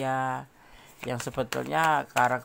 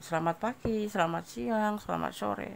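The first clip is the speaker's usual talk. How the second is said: higher, sing-song listing of the greetings; mock routine greetings; 7 semitones higher than usual. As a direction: tick off the greetings like a dull routine. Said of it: "Harus kayak minum obat". (nada tinggi meniru ucapan rutin)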